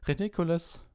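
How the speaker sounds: high squeaked spell. A narrator's reading. Neville casts his spell at Snape, squeaking. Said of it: "quiekte Neville".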